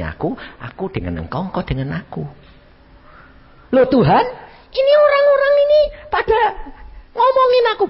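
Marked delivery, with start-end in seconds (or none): 3.7-7.8 high, loud whiny complaint; complaining believer. Acted. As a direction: whine to God about the gossip. Complaining to the Lord.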